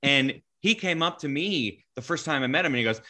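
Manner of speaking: normal talking voice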